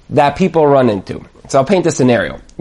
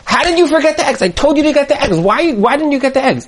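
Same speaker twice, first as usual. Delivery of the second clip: high-pitched acted exasperation; upset wife; 9 semitones higher, faster than usual. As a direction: scold your husband in a high, worked-up voice. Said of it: "the wife gets, like, really upset".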